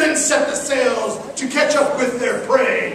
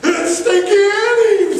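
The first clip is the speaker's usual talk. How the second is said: high, louder alarmed cry; alarmed pirate; 8 semitones higher, 4 dB louder than usual. Acted. Pirate crewman spotting Stinky Annie, high alarmed cry. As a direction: sound the alarm at the sight. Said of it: "someone said".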